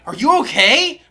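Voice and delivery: high, loud urgent question; concerned coach, loud and high; worried coach. Retold or imitated. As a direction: ask it with mounting alarm. Coach alarmed at his face.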